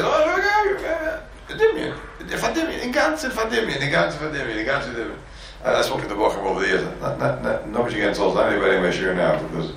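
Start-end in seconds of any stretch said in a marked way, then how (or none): 0.0-5.2 high, laughing mock self-important talk; mock pompous voice. Mocking a pompous guy to the class, high and laughing.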